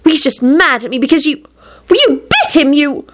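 high, broken-off accusation; upset scolding. A narrator's reading. Rosie blaming her dog, high upset scolding.

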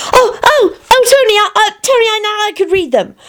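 high, excited gasping; surprised. Acted. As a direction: act out a sudden discovery. Mock surprise for child.

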